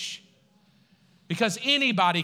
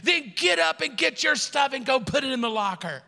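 high-pitched, irritated mock command; chief's order; 6 semitones higher than usual. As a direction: order him off like a fed-up chief.

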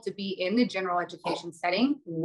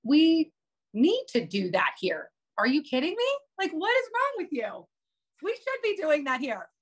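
pitched way up, incredulous and exasperated; higher, scandalized disbelief; indignant retort; 7 semitones higher, 3 dB louder than usual. Retold or imitated. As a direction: retell your outraged comeback to a colleague. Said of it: "And I'm like".

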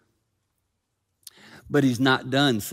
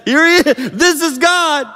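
excited shout; 11 dB louder than usual. Acted. Onlooker standing in the earthquake, excited shout. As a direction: shout it, stretching the last words.

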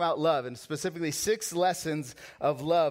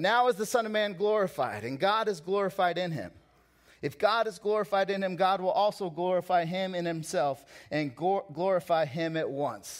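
fervent scripture reading; 4 semitones higher, 4 dB louder than usual. Acted.

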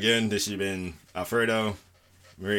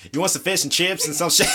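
mock country accent; 9 semitones higher, 7 dB louder than usual. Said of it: "she was like".

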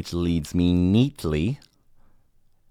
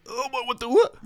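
mock baffled. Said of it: "and I'm like".

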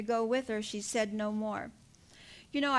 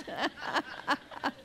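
a short soft laugh at the image; 5 dB quieter than usual. Real, not acted. Preacher laughing softly to the congregation. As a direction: chuckle quietly to yourself.

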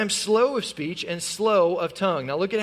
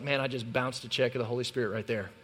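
regretful inner voice; 7 semitones lower, 6 dB quieter than usual. Retold or imitated. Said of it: "I was like".

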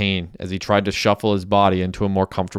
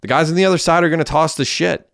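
high, urgent raised voice; pitched-up narrated shout; 8 semitones higher than usual. A narrator's reading. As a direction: call it out to the driver. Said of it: "shouted Messi".

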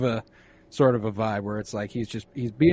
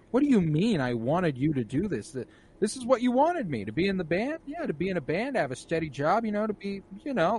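mock exasperated girlfriend; 8 semitones higher than usual. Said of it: "she's like".

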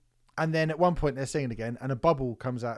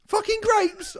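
a sudden hammed-up yell, much higher; mock dismayed shout; 5 dB louder, slower than usual. Crew member discovering the grapes, mock dismayed shout. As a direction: blurt it out as if the grapes are a disaster.

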